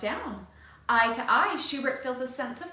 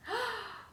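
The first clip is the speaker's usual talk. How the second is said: gasp; 12 semitones higher, 7 dB quieter than usual. A narrator's reading. Apple taken.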